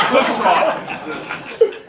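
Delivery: laughing through the words; real amused laugh. Real, not acted. Laughs at bidder's question.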